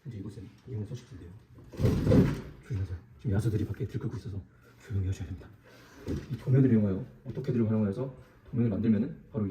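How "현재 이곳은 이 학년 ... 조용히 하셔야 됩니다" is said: hushed nature documentary. Acted. Hushed documentary narration, beasts outside.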